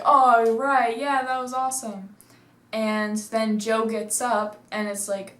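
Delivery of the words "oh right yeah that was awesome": louder, higher mock celebration; excited cheer. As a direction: cheer as if the trick worked. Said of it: "everyone's like".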